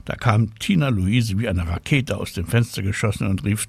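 ordinary delivery

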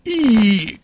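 loud high-pitched squeal of disgust; disgusted squeak. A narrator's reading. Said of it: "quietscht sie".

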